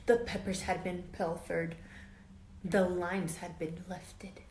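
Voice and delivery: put-on lively read-aloud for children; story narration. A narrator's reading. Teacher reading a storybook to her class, lively read-aloud. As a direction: tell it like a picture book to kids. Reads picture book to class.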